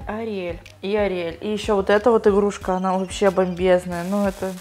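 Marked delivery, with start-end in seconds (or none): none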